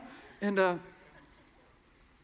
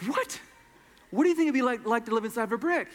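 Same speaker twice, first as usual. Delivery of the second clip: incredulous repeat; 4 semitones higher, faster than usual. Retold or imitated.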